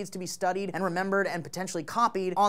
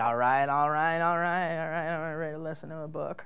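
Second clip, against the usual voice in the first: low, laid-back celebrity impression; Matthew McConaughey; 4 semitones lower than usual. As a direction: do a mellow movie-star impression, easing into a murmur. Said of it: "That's my best Matthew McConaughey impression".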